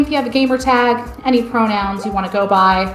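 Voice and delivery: usual speaking voice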